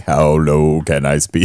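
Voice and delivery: slow, low mocking imitation; put-on low, slow voice; as low as possible. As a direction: drop the voice and drag it out, teasing. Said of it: "It was just like".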